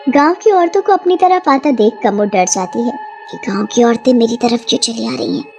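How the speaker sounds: usual speaking voice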